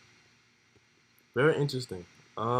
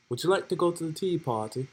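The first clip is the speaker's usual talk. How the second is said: higher-pitched British accent try-out; 6 semitones higher than usual. Shown as a demonstration. Reader testing their British accent before reading a character, British accent. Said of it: "read it in a British accent".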